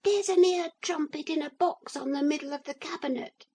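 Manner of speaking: very high, fast tiny voice; small piping far-off voice; teeny tiny voice. A narrator's reading. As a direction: rattle it off like a tiny toy man. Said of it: "teeny tiny voice say".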